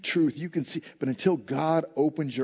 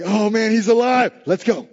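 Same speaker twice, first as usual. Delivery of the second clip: high, loud jubilant exclamation; excited; 5 semitones higher, 6 dB louder than usual. Retold or imitated. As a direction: act out disciples thrilled by the news. Disciples celebrate the resurrection.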